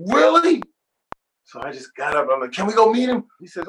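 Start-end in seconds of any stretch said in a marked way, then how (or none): 0.1-0.6 high, loud yelp of disbelief; astonished. Retold or imitated. Singer hears his idol is nearby, astonished. 2.5-3.3 higher, louder excited plea; eager. Retold or imitated. Singer begs brother to meet [name], eager.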